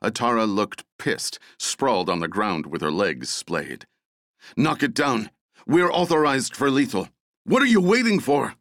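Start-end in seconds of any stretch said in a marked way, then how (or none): 4.6-8.6 higher, louder, rising to a scream; furious shout. A narrator's reading. Atara pinned under the beast, shouting at her team, furious. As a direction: bark the order to shoot her attacker. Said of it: "she shouted".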